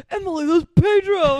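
loud, high imitated outburst; mock excited exclamation. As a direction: call out an astonished discovery, hammed up. Retelling Luis's excited discovery.

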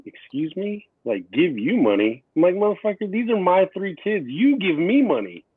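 high-pitched indignant blow-up at the man; snapping back. Retold or imitated. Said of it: "I instantly snapped and I was like".

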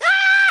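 sudden high shriek of panic; mock scream. Friend imagining squash, shrieking in mock terror. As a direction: yelp like a ball is flying at you. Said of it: "I'd be like".

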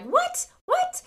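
high squeaky disbelieving what what; shocked what. Retold or imitated. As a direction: react in total disbelief, pitch way up. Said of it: "I'm like".

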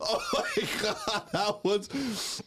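high, slow laughing through the words. Real, not acted. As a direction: gasp it out while laughing. After soda came out nose.

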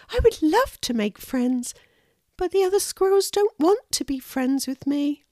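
high small-creature voice; wistful little squirrel. Acted. Lulu tells the hedgehog the others avoid her, high and wistful. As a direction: voice a little squirrel longing for friends.

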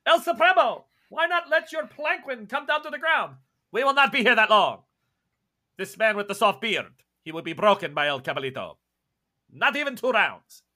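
loud, high call up to El Supremo; crowd challenger. Acted. Someone hailing El Supremo before the fight, loud proclamation. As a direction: proclaim it boldly to the ruler on high. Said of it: "And somebody says".

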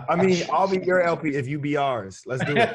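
laughing. Real, not acted. Joking offer to be LP.

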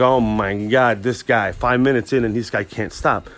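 slow, drawn-out weary disbelief; imitating an exasperated onlooker; mock exasperation. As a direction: drag out the opening words, fed up with this guy. Coworkers fed up with his chatter.

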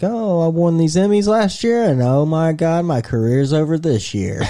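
mock TV host whining about his lost career; mocking Cuomo. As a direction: ham up a fallen star's despair. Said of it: "it's like".

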